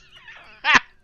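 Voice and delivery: loud, high burst of laughter. Real, not acted. Laughing at the evil carrot remark, loud cackle. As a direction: crack up loudly.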